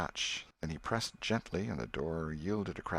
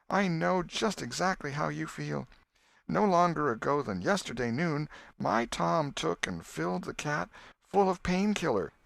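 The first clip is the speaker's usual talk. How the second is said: much higher, grieving voice; an aunt sharing her grief, tearful; 8 semitones higher than usual. A narrator's reading. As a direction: mourn the dead boy.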